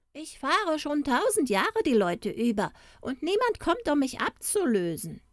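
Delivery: high-pitched storybook woman; high, plaintive ferrywoman; weary ferrywoman. A narrator's reading. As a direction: lament a thousand years of ferrying in a high voice.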